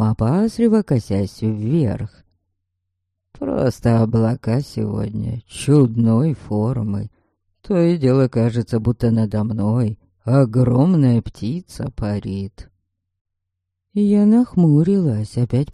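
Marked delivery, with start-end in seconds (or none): 3.3-12.7 uneasy coachman. A narrator's reading. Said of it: "пробурчал мужчина".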